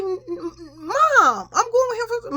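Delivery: high, loud whine to Mom; imitated childish protest. Child objecting to her mother about going with him, whining protest. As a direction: act out a stammering, wailing protest.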